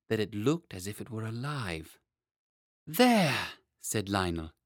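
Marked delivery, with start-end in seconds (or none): 2.9-3.5 put-on delighted boy. A narrator's reading. Boy delighted by painted butterfly.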